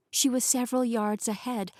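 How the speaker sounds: ordinary delivery, nothing marked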